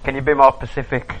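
"Pacific" said like the ocean, meaning specific; mispronounced Pacific. Retold or imitated. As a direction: say "Pacific" instead of specific, stress on -cif-. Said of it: "People who pronounce".

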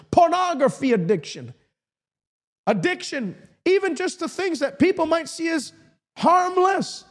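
high-pitched, emphatic preacher's delivery; fervent preaching. Real, not acted. Preacher urging the addicted to receive freedom, fervent preaching. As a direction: preach with urgency, drawing out key words.

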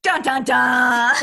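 slow, loud suspense chord; dramatic sting. Pretend. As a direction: sing out the suspense chord, slowly. Dramatic sting after the excerpt.

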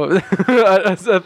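ordinary delivery

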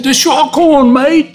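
high, loud mock Australian holler; screaming Aussie. Aussie calling to a swimmer, loud imitated Australian shout. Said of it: "one Aussie, he screams at me".

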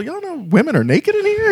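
high, incredulous whisper-shout; stunned newcomer alerting friends; mock astonishment; amazed disbelief. Tapping people in disbelief.

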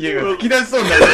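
bursting with stifled laughter; trying not to laugh. Real, not acted. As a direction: laugh while trying to hold it in.

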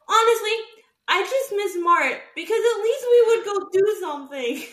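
high, loud exasperated outburst; venting outburst. Retold or imitated. Quoting her outburst to Hunter, high and loud. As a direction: blurt it out, fed up.

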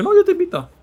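high, loud generous offer; high loud treating offer; loud insistence. Retold or imitated. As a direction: insist loudly on paying.

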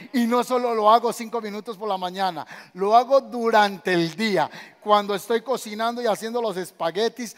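loud preaching, building; preaching at full force. Acted. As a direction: drive it home to the congregation. Predicación en voz alta. (predicación enérgica y creciente)

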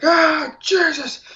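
high pained yelp. Retold or imitated. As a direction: yelp like a fresh cut. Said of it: "freaked out, and I was like".

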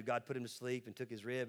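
ordinary talk, nothing marked

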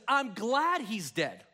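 slow, calm, weighted words; slow, deliberate statement. Retold or imitated. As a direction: say it plainly and slowly, as a settled fact. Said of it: "Jesus says".

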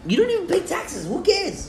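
teasing squeaky falsetto jab; mocking high voice. Friend teasing cohost about taxes, in a squeaky mocking falsetto. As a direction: pitch way up to mock him.

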